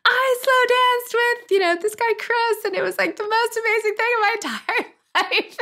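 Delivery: put-on reading her teen diary. Reading her seventh-grade diary entry aloud, high and gushing. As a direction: swoon it like a lovestruck seventh grader. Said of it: "like, diary at the time".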